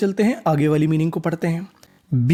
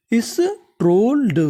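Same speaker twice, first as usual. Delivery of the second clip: slow, higher स्ट्रोल्ड; slow demo reading; 5 semitones higher, much slower than usual. Shown as a demonstration. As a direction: stretch out स्ट्रोल्ड, pitch up then falling. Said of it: "तो पूरी meaning".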